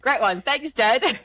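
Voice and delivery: sarcastic cheer, thrown out loudly for fun; mock-grateful jab at dad. Mock thanks to dad for naming.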